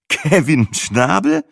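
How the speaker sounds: slow, doubting repeat of the name; incredulous. A narrator's reading. Boyfriend repeating an absurd name, slow and incredulous. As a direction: drag it out in disbelief.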